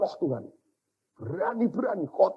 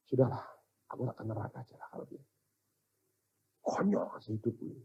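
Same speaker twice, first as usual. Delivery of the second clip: low, soft, defeated mutter; resigned despair; 4 semitones lower, 11 dB quieter, faster than usual. Retold or imitated. Preacher recalling giving up on himself, resigned. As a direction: give up quietly, voice sinking away.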